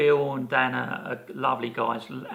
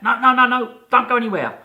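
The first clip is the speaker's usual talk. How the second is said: higher and louder, hammed-up urgency; urgent excited plea; 11 semitones higher, 9 dB louder than usual. Costume woman stopping Chris from leaving, excited and urgent. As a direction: blurt it out as if he might vanish.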